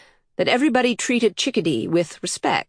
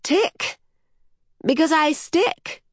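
put-on excited child's voice, pitched way up; child crying out; 10 semitones higher, slower than usual. A narrator's reading. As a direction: pipe up high like a delighted little girl. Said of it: "the child cried".

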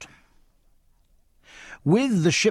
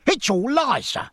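passionate cry; 12 semitones higher, 3 dB louder than usual. Acted. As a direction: hurl the denial in a rage. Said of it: "cried Rogers".

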